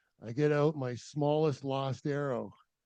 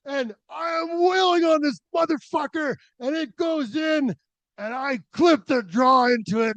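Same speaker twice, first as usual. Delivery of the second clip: louder, higher, surging with triumph; excited; 12 semitones higher, 10 dB louder than usual. Retold or imitated. Climber reliving hammering the pin, rising excitement. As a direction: ramp up as the pin bites.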